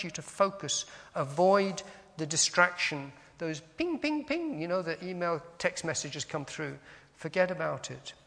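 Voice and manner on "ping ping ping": high, repeated "ping" of an alert; imitated phone pings. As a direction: mimic phone notifications pinging in high.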